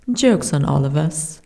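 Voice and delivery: ordinary talk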